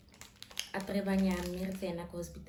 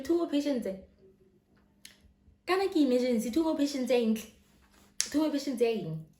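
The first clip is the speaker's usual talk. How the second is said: loud, high, incredulous repeats; mock outrage at the nurse; indignant outburst; 7 semitones higher, 8 dB louder than usual. Patient in pain scolding the nurse, loud incredulous outrage.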